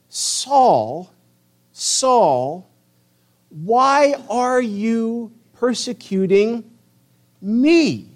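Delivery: loud, slow, high-pitched divine call; voice from heaven. Acted. The Lord calls to Saul fallen on the ground, loud slow heavenly voice. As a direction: call out with long pauses between the words. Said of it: "he heard a voice saying to him".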